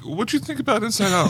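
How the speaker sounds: high, bright stranger voice; passerby voice. Retold or imitated.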